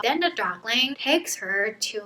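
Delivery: normal talking voice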